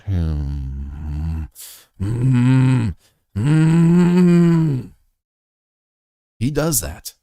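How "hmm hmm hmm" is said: hums building up much higher and louder; imitating a sulking son's hums, rising higher and louder; sulky hums. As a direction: hum in a sulk, swelling to a loud high whine. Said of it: "He made a face".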